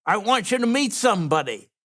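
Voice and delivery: mock yell to someone in another room; father-in-law calling him over, half-hearted yell; retold yell. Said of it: "he yells".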